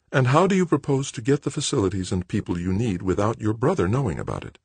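high-pitched woman's voice; mother, challenging. Acted. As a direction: sceptical mother pressing her son. Narrator voices the mother in dialogue.

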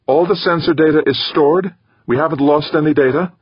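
high, eager double question; surprised. A narrator's reading. Habib asking Gaeta about the stored data, surprised. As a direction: ask in surprise, pitch lifted well up.

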